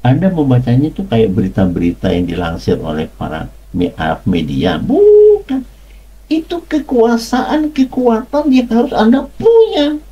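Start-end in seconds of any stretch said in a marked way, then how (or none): 4.8-10.0 preacher rising high to insist; emphatic high exclamation. Acted. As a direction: proclaim it like a revelation. Preacher insists on God's power.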